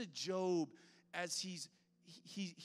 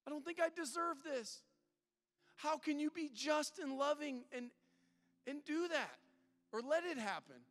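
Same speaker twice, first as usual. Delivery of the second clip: higher, pleading and bewildered; pleading Job; 9 semitones higher than usual. Retold or imitated. Preacher voicing Job arguing with God, high and pleading. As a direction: plead upward like a confused innocent. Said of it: "He's like".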